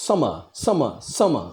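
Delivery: "summer" with force on SUM, the end light; stress on first syllable. Shown as a demonstration. Teacher splitting Shakespeare's line, "summer" stressed on SUM. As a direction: hit the opening of each "summer". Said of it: "तो स पे आ रहा".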